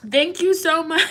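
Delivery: high thanks breaking into laughter; choked-up laughing gratitude; emotional. Real, not acted. Reader thanking a viewer, tearful laugh. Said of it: "I'm emotional".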